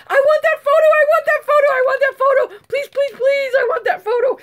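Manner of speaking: high, loud, rapid-fire begging; frantic high-pitched yell, over and over; excited screech. Real, not acted. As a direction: screech and plead in real excitement. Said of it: "I'm not supposed to screech and yell".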